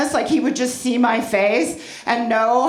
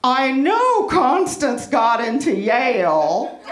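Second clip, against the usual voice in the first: humble-bragging mother; 3 semitones higher than usual. Retold or imitated. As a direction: gush like a smug Facebook mom pretending to worry. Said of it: "something like this".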